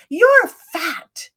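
a mother's sharp verdict; blunt mother. Retold or imitated. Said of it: "and she said".

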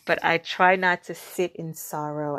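ordinary talk, nothing marked

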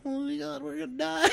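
high, laughing mock terror; laugh-crying fake fear in the truck; mock panic. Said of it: "And I'm all".

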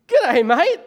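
loud, high-pitched hearty Aussie hello; exaggerated loud Australian greeting; emphatic greeting. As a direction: call it out big and high to a stranger. His emphatic Aussie reply, retold.

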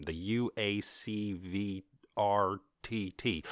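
very slow, letter by letter; laboured spelling. Shown as a demonstration. Host mocking a clumsy acronym, laboured spelling.

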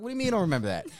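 high, mock-incredulous outburst; indignant disbelief. Husband challenges forgetful store clerk, indignant disbelief. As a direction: pitch jumps up in protest.

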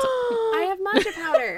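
breathless excited squeal; gasp, then blurt it out high. Real, not acted. Gasp of excitement over idea.

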